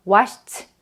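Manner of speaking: "washed" ending in an aspirated t. Shown as a demonstration. Teacher showing learners the past tense, "washed" with t ending. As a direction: say "washed" as wash-t. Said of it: "washed라고 발음을 해주시고".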